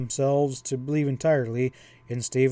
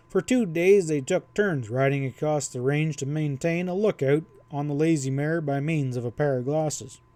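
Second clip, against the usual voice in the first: ordinary delivery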